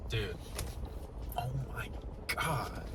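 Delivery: breathless whispered oh my God; awed whisper. Real, not acted. Vlogger murmurs to viewers, whispering in awe. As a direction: whisper it in disbelief. Hushed awe over MacBooks.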